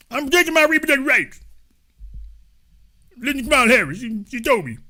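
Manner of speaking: high, fast, garbled caricature; hammed-up clueless defender; mocked commenter. Mocking caricature argues with host.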